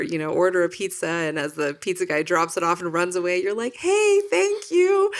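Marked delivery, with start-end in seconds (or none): 3.8-5.1 high, loud, drawn-out call; overeager calling out. Acted. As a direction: shout it gratefully after someone running off. Calling after fleeing pizza guy.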